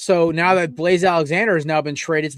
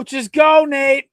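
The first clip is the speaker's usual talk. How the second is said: exasperated order; 8 semitones higher, much slower than usual. Real, not acted. Host banishes guest to penalty box.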